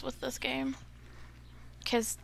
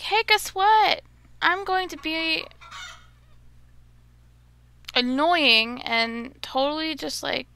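higher, louder, slow taunting voice; mocking annoying chicken; 5 semitones higher, 8 dB louder than usual. Mocking the chickens in the quest, higher taunting voice. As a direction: play the smug pest. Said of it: "It's like".

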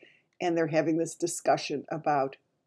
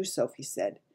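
ordinary delivery, nothing marked

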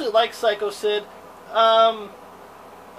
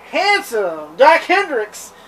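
slow, louder announcer delivery; 5 semitones higher, 8 dB louder, much slower than usual. Acted. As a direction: draw out the name like an announcer. Reading the character's name.

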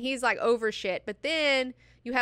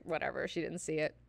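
soft, lower, offhand brush-off; dismissive shrug; 4 semitones lower, 7 dB quieter than usual. Retold or imitated. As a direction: wave it away quietly. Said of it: "Irulan's just like".

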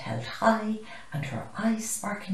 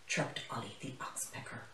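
usual speaking voice

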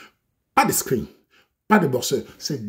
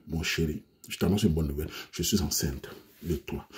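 sweet cooing; 9 semitones lower, 8 dB quieter than usual. Acted. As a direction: breathe it out sweetly on the phone. Girlfriend's sweet phone news.

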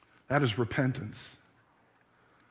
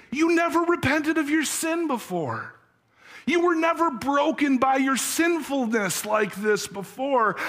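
comforting wife; 7 semitones higher than usual. Acted. Wife comforts her weeping husband.

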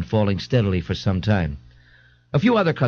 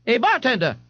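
calling out. A narrator's reading. A mother calls to the bartender, calling out. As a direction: hail the bartender in a high voice. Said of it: "Missus Riley called".

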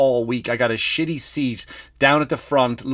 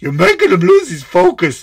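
loud, raised cartoon heckle; high, exaggerated movie-character yell; Monsters, Inc. character; 5 semitones higher, 7 dB louder than usual. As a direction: shout it in a put-on cartoon voice. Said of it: "kinda like the guy from Monsters".

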